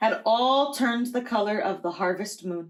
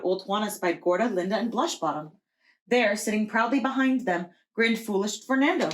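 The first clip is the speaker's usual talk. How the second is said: usual speaking voice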